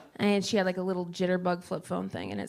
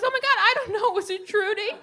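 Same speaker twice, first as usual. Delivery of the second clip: very high, louder flustered guess; flustered panic; 13 semitones higher, 6 dB louder than usual. Retold or imitated. Mom panicking at Sprint's quiz, very high flustered. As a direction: spiral into comic panic.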